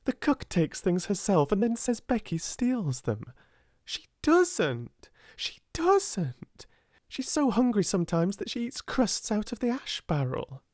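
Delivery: high, passionate protest; indignant outburst. A narrator's reading. Sarah standing up for hungry Becky, indignant outburst. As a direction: defend her hotly in a high voice. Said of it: "she burst forth".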